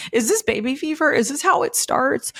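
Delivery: put-on half-joking baby fever alarm; panicked wonder. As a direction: ask like it just dawned on you. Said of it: "I was like".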